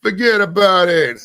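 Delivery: hammed-up New York gangster accent. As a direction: lean into a Sopranos-style New York accent. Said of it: "Sound like The Sopranos ... New York accent".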